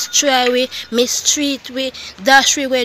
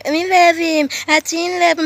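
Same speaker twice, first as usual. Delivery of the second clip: high mock-lovesick voice; mimicking lovestruck women; 6 semitones higher, slower than usual. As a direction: imitate a woman hopelessly in love, pitched up. Mimics women blinded by love.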